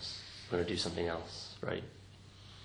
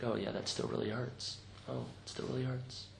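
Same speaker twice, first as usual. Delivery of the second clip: gentle noticing; 4 semitones higher, 6 dB quieter than usual. Acted. Voices gently noticing the pain again.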